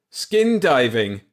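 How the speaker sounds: loud, slow giddy excitement; giddy hysterical. Acted. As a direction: rattle it off in near hysteria. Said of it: "as hysterical as she was".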